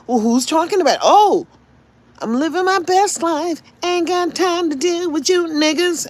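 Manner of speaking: high, far-off mocking singsong; mock the carefree partier; carefree party voice.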